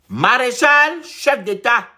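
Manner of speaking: loud, high-pitched proclamation; ringing mock tribute to a ruler; mock acclamation. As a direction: proclaim the title like a herald.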